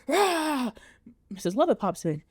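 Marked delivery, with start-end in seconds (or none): 0.0-0.7 high mock roar of rage; mad roar. Todd raging through his song, hammed-up roar. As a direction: let out a hammed-up furious roar. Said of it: "and he's mad".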